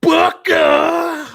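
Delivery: high shouted royal proclamation; King Booker shout. Retold or imitated. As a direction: bellow the name like a king.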